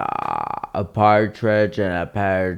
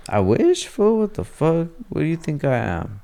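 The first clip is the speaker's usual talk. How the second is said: high, quick mock outrage; mock offended; 8 semitones higher than usual. Real, not acted. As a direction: act insulted, jokingly.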